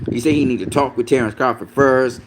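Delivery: usual speaking voice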